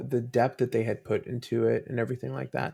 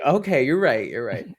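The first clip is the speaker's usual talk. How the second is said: louder, resigned giving in; conceding; 3 semitones higher, 5 dB louder than usual. Retold or imitated. Dad giving in to his daughter, louder at first. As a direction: concede with a smile in the voice. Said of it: "And I'm like".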